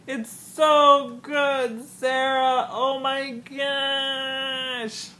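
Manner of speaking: high, very slow drawn-out wail; swooning fan whine; overwhelmed gushing. Real, not acted. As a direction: moan with delight, stretching each word. Overcome gushing about the show.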